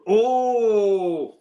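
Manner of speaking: long, high drawn-out oh; surprised realization. Real, not acted. Host spotting a game on the schedule, surprised realization. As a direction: stretch out a high oh of discovery.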